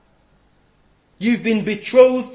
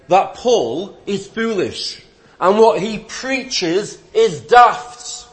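usual speaking voice

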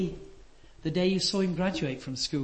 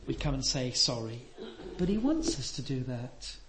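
ordinary delivery

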